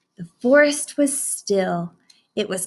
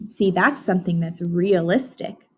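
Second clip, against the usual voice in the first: usual speaking voice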